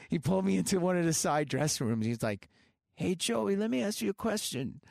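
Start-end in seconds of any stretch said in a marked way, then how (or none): 3.0-4.8 put-on a light, self-admittedly weak English Midlands accent, pitched higher; imitating a rough English rock-star accent, not quite landing it. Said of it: "I can't do his accent that great".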